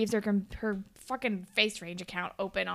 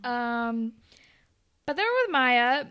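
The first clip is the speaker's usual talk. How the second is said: usual speaking voice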